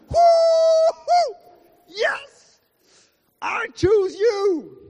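high shouted whoop of delight; joyful whoop. Acted. Preacher voicing God's delight in choosing you. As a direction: cheer like you just won. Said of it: "He went like".

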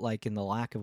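ordinary talk, nothing marked

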